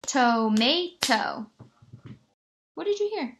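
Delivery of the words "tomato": "tomato" slow in three beats, TOE, MAY, TOE, full vowels; split into syllables. Shown as a demonstration. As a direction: count out "tomato" slowly, each syllable distinct. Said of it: "Let's try pounding it".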